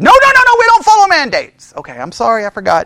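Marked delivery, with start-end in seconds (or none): 0.0-1.7 high-pitched frantic mock protest; hammed-up outburst of objection; losing their minds. People reacting to the word mandate, mock hysterical protest. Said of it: "everybody lost their minds".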